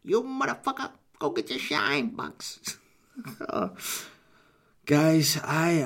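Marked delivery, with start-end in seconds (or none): 0.0-0.9 much higher squeaky mob voice; high mobster insult. Acted. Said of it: "If you like that kind of voice". 1.2-2.8 slow, quieter mobster sneer; Goodfellas taunt. Acted. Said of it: "that kind of voice". 3.2-4.0 breathy laugh. Real, not acted. Laughing at own voices.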